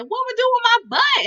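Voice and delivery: high, loud mock outrage; alarmed protest. Boy told there are no washcloths, loudly alarmed. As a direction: act out a boy's loud, high-pitched objection.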